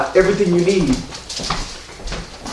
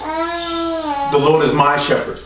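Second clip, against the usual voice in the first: higher and slow, fervent; lifted solemn quote; 8 semitones higher, 3 dB quieter, much slower than usual. Retold or imitated. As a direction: raise it up and stretch it out. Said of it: "He said".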